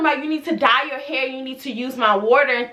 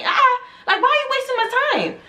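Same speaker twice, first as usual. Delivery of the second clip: exasperated squeal; 11 semitones higher, faster than usual. Retold or imitated. Inner rant about a late client.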